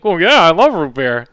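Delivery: loud, keen mock enthusiasm; imitating eager dad. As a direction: jump at the offer as dad. Dad keen on offered root beer.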